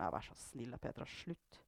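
low, very soft request to stop; subdued plea. A narrator's reading. Storm asking Petra to stop, quietly pleading. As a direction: plead under your breath.

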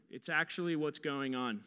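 ordinary delivery

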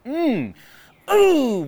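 high, loud ooh of mock shock; a sharp ooh as if it stings. Real, not acted. Preacher reacting to the hard verse, a high loud ooh.

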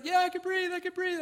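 high, loud mock-panicked child; retold high-pitched panic. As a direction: imitate a frightened boy answering urgently. Retold frightened son answering dad.

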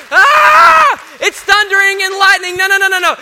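high, loud hammed-up fright at thunder; mock panic. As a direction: yelp like a scared disciple, half in jest.

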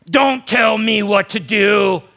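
slow, loud, defiant snap back; defiant retort. Acted. Pastor mocking a defiant world, loud and slow. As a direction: throw it back like a rebellious child. Rebellious retort voiced in sermon.

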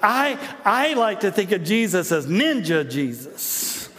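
put-on slow, drawn-out kid's musing; lightly voiced child sharing an idea; kid in the movie. Movie kid picturing Jesus at grace, lightly voiced child. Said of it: "the kids are going".

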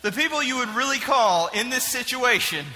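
ordinary talk, nothing marked

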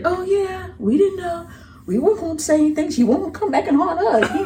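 mock the aunts' shrugging excuse; high dismissive aunts' voice. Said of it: "So they was like".

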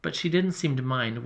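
usual speaking voice

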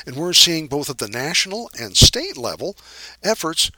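usual speaking voice

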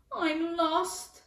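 put-on high, plaintive child voice; sniffly little boy. A narrator's reading. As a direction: whimper it like a lost child. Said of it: "sniffed the boy".

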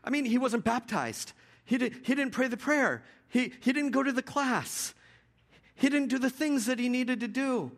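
high-pitched mock-alarmed protest; flustered objection. Longtime believers object to the thief's easy salvation, mock indignation. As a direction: stammer in, then indignantly list the objections. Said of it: "we go".

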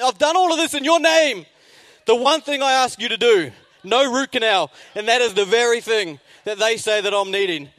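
high, loud tirade at God, hammed up; angry complaint. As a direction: build an indignant plea to a peak, then ease. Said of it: "I was angry, I was negative".

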